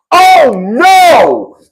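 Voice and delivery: loud, high wail of alarm; mock alarmed attorney. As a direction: cry out in hammed-up horror. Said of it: "Her attorney is gonna say".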